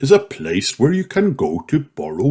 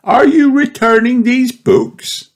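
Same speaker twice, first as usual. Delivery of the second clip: Miss Rabbit's voice; 4 dB louder than usual. A narrator's reading. Miss Rabbit asks Mummy.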